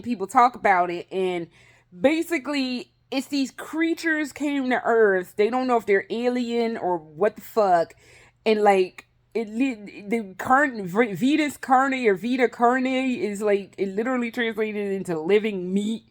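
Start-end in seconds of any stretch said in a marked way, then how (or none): none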